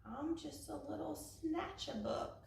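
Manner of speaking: soft, high and sorrowful; mournful mumble. A narrator's reading. The creature shyly confesses to Eliza, soft and mournful. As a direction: hang your head and admit it. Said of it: "He mumbled with a mournful look".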